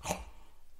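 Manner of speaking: sharp voiceless gasp of surprise; retold gasp. Player hearing he will start the game, acted gasp. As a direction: suck in air, acting stunned. Reacting to being told he starts.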